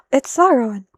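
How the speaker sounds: startled discovery, blurted out; gasping surprise. Real, not acted. Sudden surprise at the page.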